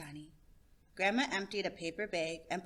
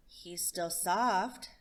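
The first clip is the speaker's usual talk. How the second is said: slow and thoughtful; grandma speaking gently over the bird; much slower than usual. Acted.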